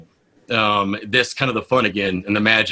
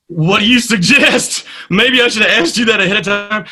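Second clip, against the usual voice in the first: flustered mock cheer; 10 semitones higher, 4 dB louder than usual. Unprepared host turning to the station manager, flustered. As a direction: stall loudly in a high voice. Retold flustered answer, high, loud, slow.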